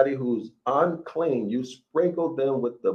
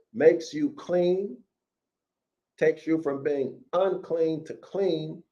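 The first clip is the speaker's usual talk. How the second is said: ordinary talk, nothing marked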